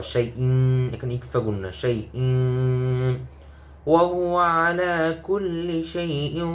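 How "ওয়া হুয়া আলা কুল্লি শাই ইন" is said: higher chanted phrase; melodic recitation. Shown as a demonstration. Teacher chanting the joined phrase for students. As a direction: recite it like tilawah. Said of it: "teacher models full phrase".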